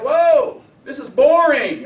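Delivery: loud, high, slow mock whine; bored client. Outsider client in his calm world, loud mock complaint. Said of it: "It's like".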